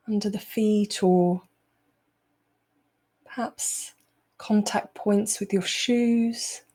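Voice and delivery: unhurried meditation voice, long pauses between phrases; guided meditation. Acted. Teacher easing the group out of a busy day, guided meditation. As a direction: guide them calmly into the present moment.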